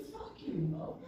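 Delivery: half-whispered mock curse through a laugh; soft laughing jab; muttered curse. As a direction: toss it off under the breath, laughing. Bonaducci's parting shot, retold.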